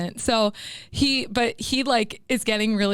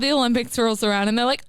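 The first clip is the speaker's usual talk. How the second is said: ordinary delivery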